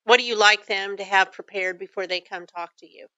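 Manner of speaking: usual speaking voice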